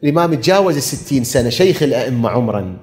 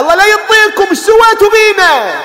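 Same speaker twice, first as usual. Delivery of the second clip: high, loud mourning cry to the crowd; full-force lament preaching; 11 semitones higher, 6 dB louder than usual. Acted. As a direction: preach the tragedy at the top of your voice. (خطابة حسينية بأعلى صوت)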